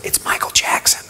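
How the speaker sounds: hushed retold whisper. Friend whispering who is on the phone, hushed. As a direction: breathe it like a secret.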